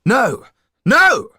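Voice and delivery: high, loud furious cry of no; outraged shouting; angry shout. Acted. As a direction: yell it in protest. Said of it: "shouted Yann angrily".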